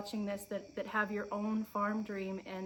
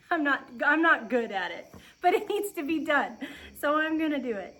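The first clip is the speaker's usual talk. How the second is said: louder, laughing at herself through the words; 5 semitones higher, 9 dB louder than usual. Real, not acted. Farm vlogger laughing about being bad at bucking hay, louder and laughing. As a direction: giggle it out, self-mocking.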